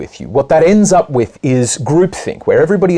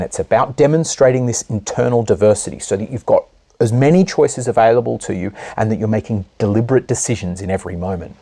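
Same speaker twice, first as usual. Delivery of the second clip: ordinary talk, nothing marked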